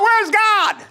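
high, loud mock-panicked cry; freaking out. Pastor acting out people needing a miracle, freaking out. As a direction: wail it upward in comic desperation. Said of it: "we freak out".

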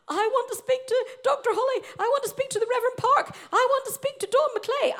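put-on high, loud, insistent child's wail; hammed-up shrieking protest; child's loud demand. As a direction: plead at the top of your voice like a kid in trouble. Retells lost child demanding advocates.

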